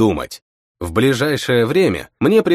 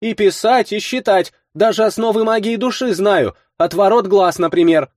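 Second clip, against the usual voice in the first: put-on proud girl voice; 9 semitones higher than usual. A narrator's reading. Girl boasts.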